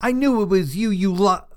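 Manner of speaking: high, triumphant gotcha; proud accusation. A narrator's reading. Girl flings door open on suspected prankster, proud accusation. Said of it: "I bolted up and said proudly".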